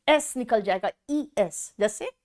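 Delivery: usual speaking voice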